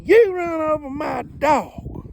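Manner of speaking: high, slow, loud hollering. Retold or imitated. Angry dog owner accusing the driver, slow loud holler. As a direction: yell it slowly at the boy who hit your dog. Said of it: "She hollered at me".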